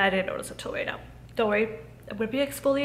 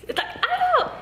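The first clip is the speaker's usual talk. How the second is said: giggling. Real, not acted. Overwhelmed, laughing at the opportunity.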